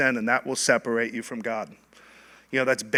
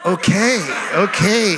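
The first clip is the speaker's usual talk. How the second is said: higher, louder, with a laugh; caught-off-guard okay; 6 semitones higher, 5 dB louder, slower than usual. Retold or imitated. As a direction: fumble for words, startled. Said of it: "he was like".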